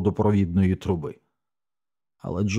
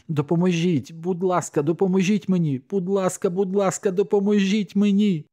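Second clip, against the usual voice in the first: much higher, desperate cry for help; screamed plea; 12 semitones higher than usual. A narrator's reading. Narrator screaming for someone to help with the sick dog, screamed plea. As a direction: beg for help in a high, rising cry. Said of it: "Тоді я закричала".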